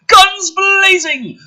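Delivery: high, loud, slow mock action-movie cry; hammed-up action cry. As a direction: ham up the battle call.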